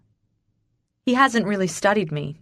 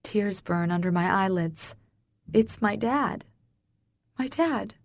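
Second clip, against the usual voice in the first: plain reading voice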